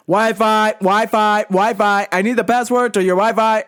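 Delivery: insistent, repetitive mock deaf man's voice; yelling deaf customer. Deaf customer runs over demanding Wi-Fi, imitated yelling. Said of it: "Just yelling, man".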